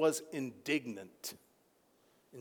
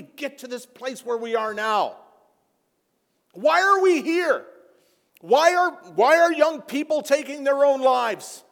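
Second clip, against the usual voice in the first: higher and louder, driving each point home; fiery preaching; 10 semitones higher, 9 dB louder than usual. Acted. Pastor rebuking his congregation, preaching with force.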